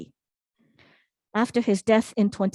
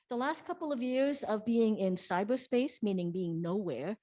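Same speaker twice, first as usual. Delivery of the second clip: ordinary talk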